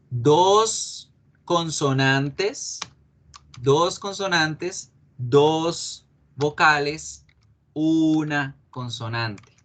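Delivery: drawn-out numbers with spaces between; slow, deliberate counting. Shown as a demonstration. Teacher counting consonants and vowels in sleep, slow deliberate counting. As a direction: count it out slowly for learners.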